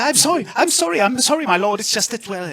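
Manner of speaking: high, flustered string of apologies; jittery. Acted. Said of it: "no need to be all jittery".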